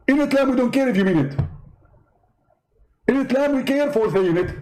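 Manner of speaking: high, triumphant preacher voice; Zakir Naik gloating. Acted. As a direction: crow over the win, pitched well up. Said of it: "Zakir Naik?".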